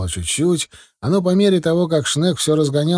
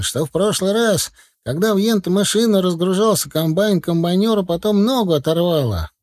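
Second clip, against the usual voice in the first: high, agitated account; mild narrated shout; 7 semitones higher than usual. A narrator's reading. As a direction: insist higher, without much volume. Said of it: "кричал Степашин". (взволнованно, высоким голосом, без крика)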